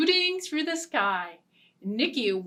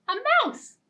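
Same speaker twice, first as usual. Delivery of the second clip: squeaky mouse; 12 semitones higher than usual. A narrator's reading. Mouse enters in story.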